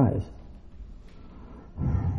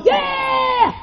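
very high, drawn-out cheer; crowd cheer. Acted. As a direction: cheer like one of an excited crowd.

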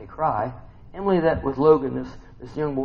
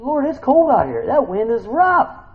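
high, loud and slow lament; plaintive complaint; 11 semitones higher, 8 dB louder, slower than usual. Acted. Believer complaining to the Lord about the cold wind, high and loud. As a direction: moan it up to heaven.

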